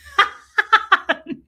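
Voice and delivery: loud, high cackling laugh; burst of laughter. Real, not acted. Recalling Tommy hearing the story, helpless laughter. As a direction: crack up in a quick staccato burst.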